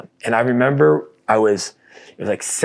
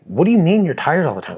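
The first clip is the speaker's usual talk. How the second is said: exasperated; 7 semitones higher than usual. Retold or imitated. Retold exasperated question to wife.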